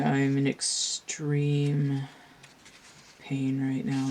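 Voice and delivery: normal talking voice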